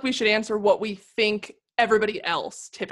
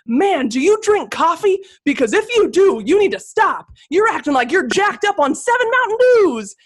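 mock gruff stranger; 9 semitones higher, 7 dB louder than usual. [name] imitating the man on her tour who scolded her. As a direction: ham up the blunt tour guest's scolding. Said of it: "That is a direct quote".

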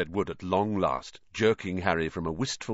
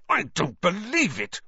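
high-pitched, tense outrage; voiced hiss rising high with outrage; outraged hiss; 10 semitones higher than usual. A narrator's reading. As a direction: hiss it in indignant disbelief. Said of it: "Wood hissed in outrage".

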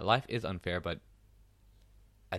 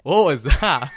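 mocking Indian accent; 7 semitones higher, 16 dB louder, much slower than usual. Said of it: "I'm making fun of you".

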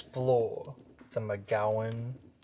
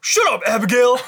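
put-on much higher, louder teen yell; teen shout; 13 semitones higher, 15 dB louder, much faster than usual. Voicing Todd yelling.

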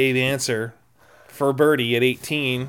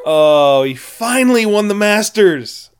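a loud, high burst of joy; the word finally lifted high and loud; excited cheer; 8 semitones higher, 9 dB louder than usual. Real, not acted. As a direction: let the relief of the win out. Celebrating the Masters win.

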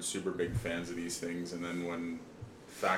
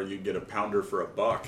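ordinary delivery

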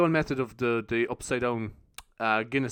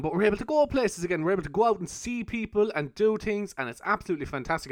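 higher, upbeat radio energy; 6 semitones higher than usual. Real, not acted. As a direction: sell it like a radio host. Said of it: "do I sound so high energy".

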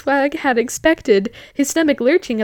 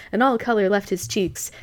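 ordinary talk, nothing marked

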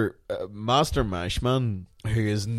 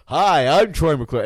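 slow, louder announcer greeting; a showman introducing himself; cheesy TV host hello; Troy McClure; 5 semitones higher, 8 dB louder, slower than usual. Retold or imitated. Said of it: "'cause he's".

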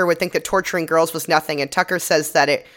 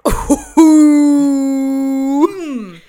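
big burst of high laughter; loud laugh; 9 semitones higher, 6 dB louder than usual. Real, not acted. Cohost cracking up at the dunk, loud laughter. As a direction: crack up loudly.

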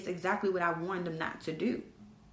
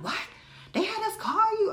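high-pitched, incredulous questioning; mock astonished castmates; imitated shock; 4 dB louder than usual. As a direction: mimic their disbelief, pitch shooting up.

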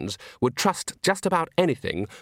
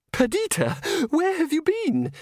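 very high, squeaky-pitched scolding; drawn-out high reproach, not truly hushed; hissed reproach; slower than usual. Acted. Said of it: "she hissed".

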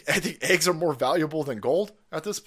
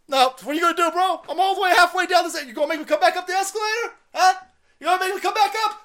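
put-on much higher, fast, cocky kid voice jeering back up the escalator; defiant kid taunting; 13 semitones higher, 5 dB louder, faster than usual. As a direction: taunt him like a bratty kid, pitched way up. Retold childhood self taunting guard.

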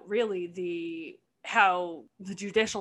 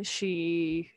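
ordinary delivery

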